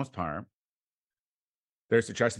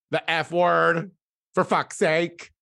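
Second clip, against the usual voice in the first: exasperated rant; 7 semitones higher, 7 dB louder, slower than usual. Real, not acted. Ranting at platform.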